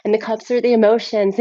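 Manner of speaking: normal talking voice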